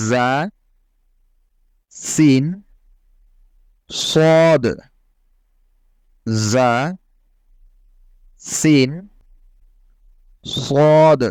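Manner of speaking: sharp hissing Arabic জা সিন সদ; Arabic letter demo. Shown as a demonstration. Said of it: "যেন জাকে বাংলা বর্গাক্ষর মতো জা পড়া না হয়".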